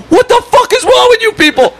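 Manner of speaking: very high, strained mock yell; retold irate neighbor. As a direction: imitate an outraged neighbor hollering. Acts out irate neighbor confronting them.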